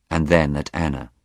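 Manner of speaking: usual speaking voice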